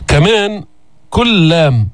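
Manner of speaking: ordinary talk, nothing marked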